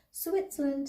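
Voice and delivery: put-on high, wondering little-girl voice; Anna. A narrator's reading. Girl hearing they have crossed the border, high and wondering. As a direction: pitch it up like a child taking in news. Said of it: "said Anna".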